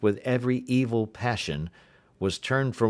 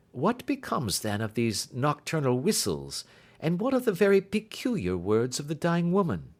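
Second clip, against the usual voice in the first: a lightly raised, brisk Holmes; 7 semitones higher than usual. A narrator's reading. Holmes asking Watson his view, lightly voiced. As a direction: lift the voice into Holmes, curious.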